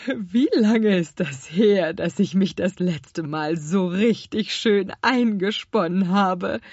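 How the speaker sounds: slow, loud, plump moth voice; clucking fat moth. A narrator's reading. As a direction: chuckle it slowly like a fat moth. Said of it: "Gluckste die dicke Motte". (glucksend wie eine dicke Motte)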